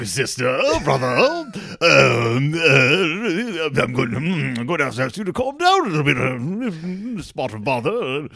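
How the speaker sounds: posh British papa voice, a bit overdone; British accent. Retold or imitated. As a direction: imitate the dad in a mild British accent. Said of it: "slight, like, British accent".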